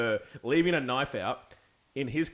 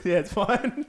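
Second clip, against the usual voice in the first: ordinary talk, nothing marked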